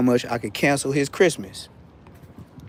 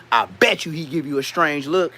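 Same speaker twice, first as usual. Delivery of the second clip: normal talking voice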